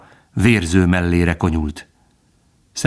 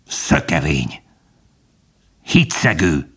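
low, restrained growl; name the crimes with grim composure; bitter accusation; 3 semitones lower than usual. A narrator's reading. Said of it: "De a róka folytatta". (elkeseredett vádként)

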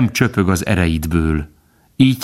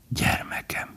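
breathless whisper, much softer; 12 dB quieter, slower than usual. A narrator's reading. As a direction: let the word slip out in a whisper. Word repeated, slipped out softly.

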